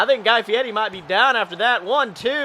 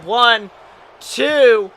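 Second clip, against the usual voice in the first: pinfall count; 2 semitones higher, 7 dB louder than usual. Acted.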